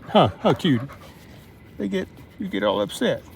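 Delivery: playful teasing dog talk. Real, not acted.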